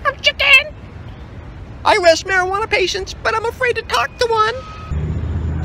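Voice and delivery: high squawking chicken voice; mock a cowardly official as a clucking hen; Bonnie Dumanis. Activist mocking the official afraid to talk, squeaky chicken impression. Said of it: "That's my Bonnie Dumanis impression".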